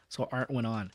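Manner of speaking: ordinary delivery, nothing marked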